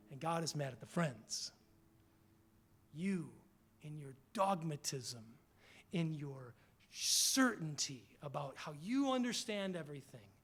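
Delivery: ordinary talk, nothing marked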